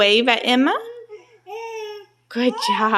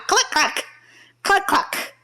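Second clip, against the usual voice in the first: high playful hen clucks; high-pitched chicken imitation; chicken clucking; 9 semitones higher than usual. Shown as a demonstration. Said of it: "what sound does a chicken make".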